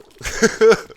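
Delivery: high, loud outburst of real laughter; loud burst of laughter. Real, not acted. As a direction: crack up at your own story. Laughing at his own fail.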